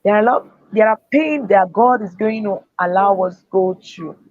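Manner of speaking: usual speaking voice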